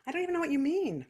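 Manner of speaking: high, soft, quick mock innocence; lucky singer. Teacher mimicking lucky singers without a break, mock clueless. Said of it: "some of you are luck".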